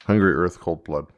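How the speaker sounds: ordinary talk, nothing marked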